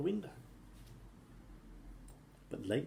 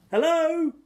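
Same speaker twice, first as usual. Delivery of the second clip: high, loud call upward; shouted up; 9 dB louder than usual. A narrator's reading. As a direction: call up to the giantess. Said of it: "Jack shouted up".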